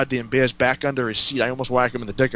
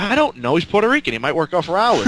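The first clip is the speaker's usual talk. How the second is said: higher-pitched, mildly exasperated reply; mock-helpless, pitched-up protest; retold defensive shrug; 6 semitones higher, 3 dB louder than usual. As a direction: plead ignorance in a raised pitch. Retold defensive reply to impatient promoter.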